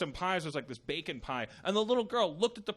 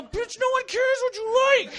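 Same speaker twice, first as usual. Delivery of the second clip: high, loud mock rant; mock yelling at a kid; 8 dB louder than usual. Comedian acting out what he wanted to yell at the girl, loud high mock rant. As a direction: snap it at the kid like you've lost it. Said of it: "I wanted to go".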